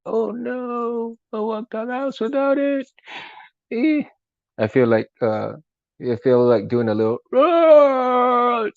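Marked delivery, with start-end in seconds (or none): 0.1-4.0 high squeaky whine; mock panic. Retelling the cold morning in a high mock whine. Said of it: "I was like". 7.3-8.7 high roaring growl; Chewbacca roar. Shown as a demonstration. Vlogger shows fans his Wookiee roar, a high roar. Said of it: "Chewbacca roar".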